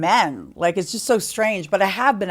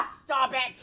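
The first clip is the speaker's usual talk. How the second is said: laughing; 9 semitones higher, 5 dB louder than usual. Real, not acted. Laughing at Steve's self-deprecating joke.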